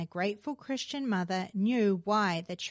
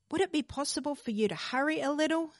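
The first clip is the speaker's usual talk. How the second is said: heartbroken plea; 6 semitones higher, faster than usual. A narrator's reading. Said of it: "said the heartbroken mother".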